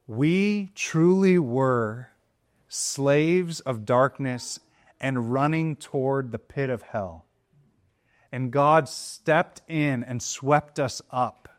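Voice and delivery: slow, weighty phrasing; slow solemn preaching. Real, not acted. Preacher stressing salvation, slow and solemn. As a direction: lay out each phrase with gravity.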